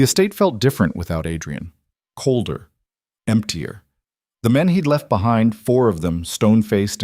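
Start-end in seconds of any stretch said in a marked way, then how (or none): none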